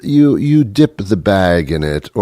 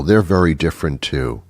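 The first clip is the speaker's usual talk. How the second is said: usual speaking voice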